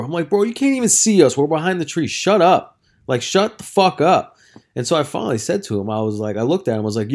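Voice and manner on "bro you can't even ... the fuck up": loud, higher-pitched retort; acted-out fed-up outburst; exasperated telling-off. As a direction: snap back at a complainer, raised voice. Retold telling-off of golfer.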